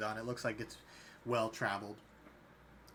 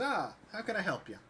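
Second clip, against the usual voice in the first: friendly barkeep voice; 6 semitones higher than usual. Acted.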